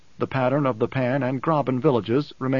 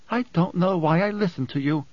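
higher, understated irritated growl; growled irritation; 5 semitones higher than usual. A narrator's reading. Woman annoyed at herself for listening, mild growl. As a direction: grumble it with a touch of anger. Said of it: "she growled".

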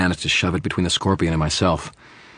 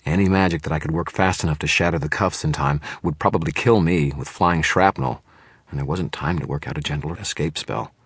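ordinary delivery

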